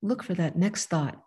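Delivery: calm instruction to close the eyes; guided meditation. Acted. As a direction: ease them into stillness, unhurried. Leads a short guided meditation.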